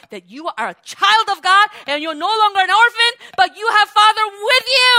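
preacher rousing the congregation, full force; preaching at full force. Acted. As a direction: lift the room, build to hallelujah. Preaching climax with hallelujah.

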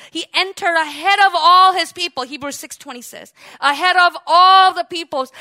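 loud preacher proclamation; full-force preaching. Real, not acted. Preacher proclaiming Christ's throne to the congregation, forceful preaching. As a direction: drive each phrase home from the pulpit.

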